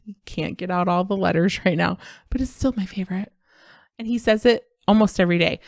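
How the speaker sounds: ordinary talk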